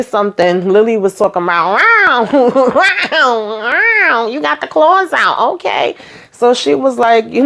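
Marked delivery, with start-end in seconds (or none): 1.7-6.0 high, drawn-out mocking yowl; mock catty taunt. Lily teasing Victoria about her claws, high mocking taunt. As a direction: tease in a high put-on voice, stretching each wow.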